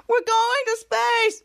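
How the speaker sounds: high, drawn-out excited cry; mock thrilled shout. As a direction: act out space-trip excitement. Acted thrill of going to space.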